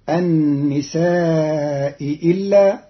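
النساء إلا with the first hamza eased between, slowly; النساء إلا slow with a half hamza; softened hamza. Shown as a demonstration. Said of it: "بتسهيل الهمزة الأولى بين بين".

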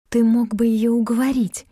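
plain reading voice

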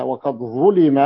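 "ظلم" as ẓulima; u-i vowels on "ظلم"; passive vowelling. Shown as a demonstration. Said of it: "ضبطت هنا".

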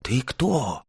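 high astonished question; surprised. A narrator's reading. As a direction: ask it in wide-eyed surprise. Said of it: "с удивлением уставились друг на друга".

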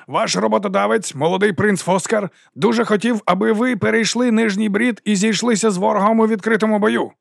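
high, sustained public address; ringing oration. A narrator's reading. Captain reports the prince's wish to troops, ringing oratory. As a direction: announce it to the whole army. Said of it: "Його голос дзвенів".

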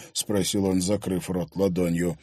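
ordinary delivery, nothing marked

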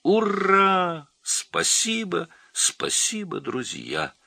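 very high, jubilant cheer easing into thanks; elated, squealing gratitude; overjoyed boa. A narrator's reading. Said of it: "счастливый удав".

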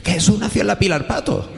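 high insistent claim with a laugh; insistent caller. Retold or imitated. Caller insisting to the author, high and laughing. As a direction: squeak out the absurd claim. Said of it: "Y me dice".

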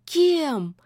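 high, alarmed, horrified questions; horrified hiss. Acted. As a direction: recoil in sudden terror. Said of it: "в ужасе прошипел Баюн".

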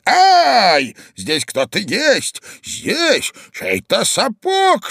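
high yelp, then drawn-out exclamation; husband's cry. A narrator's reading. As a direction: cry out slowly in startled discovery. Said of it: "закричал муж". (испуганный крик мужа)

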